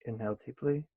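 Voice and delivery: quiet cue to breathe in deeply; breath cue. Acted. Cue between chants.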